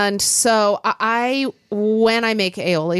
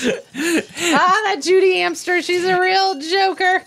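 laughing joke; 10 semitones higher than usual. Pretend. Joke quote of mom's friend.

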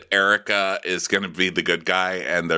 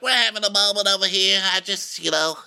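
high put-on diva voice; hammed-up high voice, lecturing self-importantly; Erica; 11 semitones higher than usual. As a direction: hold a high, exaggerated housewife impression. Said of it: "So Erica's like".

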